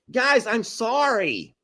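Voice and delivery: slow, high-pitched apology; whiny plea for forgiveness; apologetic. Real, not acted. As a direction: drag out a pleading sorry. Apologising to upset viewers.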